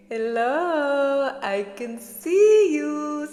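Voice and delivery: put-on high, slow Middle Eastern accent; playful Middle Eastern accent, drawn out. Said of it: "I always do the Middle Eastern accent".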